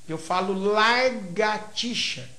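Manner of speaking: "largatixa" with r moved into the first syllable, slowly; swapped sounds. Shown as a demonstration. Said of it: "em vez de falar".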